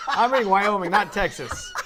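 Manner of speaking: loud, high laughing admission. Real, not acted. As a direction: blurt it out through laughter. Laughing at own wrong search result.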